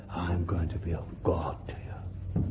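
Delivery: whispered, breathy awe; hushed whisper. Acted. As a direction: breathe God's promise softly. Preacher hushes God's promise.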